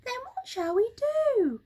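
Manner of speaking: high, anxious monkey voice; high fretful monkey; worried monkey cry. Acted. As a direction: Mugglewump frets about the cold. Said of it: "cried Mugglewump".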